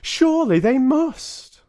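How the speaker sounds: high, loud, drawn-out protest; crying out. Acted. Boy mouse protests to grandmother, a loud high cry. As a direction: refuse to believe it, slowly and loud. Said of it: "I cried".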